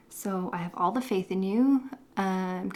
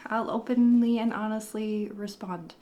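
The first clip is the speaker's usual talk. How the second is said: ordinary delivery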